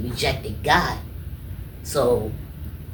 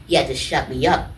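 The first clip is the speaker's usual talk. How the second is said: ordinary talk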